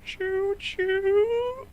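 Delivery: very high, loud mock train whistle; loud squeaky cartoon mouse; Mickey Mouse. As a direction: wail it in mouse falsetto. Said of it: "He's like".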